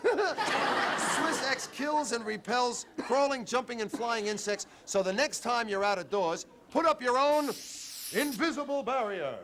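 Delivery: high, slow, laughing sales pitch; ad read. Acted. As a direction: announce the product with a grin.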